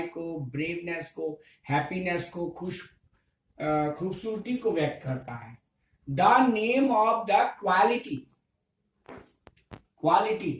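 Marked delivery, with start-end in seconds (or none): 6.1-10.5 very slow, word by word English definition; slow classroom dictation. Shown as a demonstration. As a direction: dictate it slowly so students can write.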